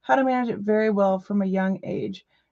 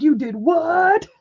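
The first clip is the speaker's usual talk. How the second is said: stunned disbelief; 7 semitones higher, 5 dB louder, slower than usual. Retold or imitated. Disbelief at his big jackpot win.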